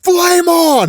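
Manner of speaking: high, loud heroic shout; battle cry. Retold or imitated. Johnny charging the swarm at the end, battle cry. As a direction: belt out a catchphrase, long and high.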